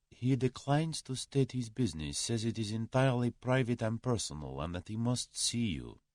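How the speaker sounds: softly voiced deferential secretary; secretary reporting. A narrator's reading. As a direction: report discreetly to your employer, softly.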